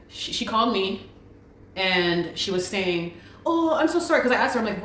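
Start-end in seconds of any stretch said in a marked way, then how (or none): 3.5-4.2 high-pitched mimicked apology; put-on high "so sorry" voice; mock apology. Mimicking the lady's excuse on the call.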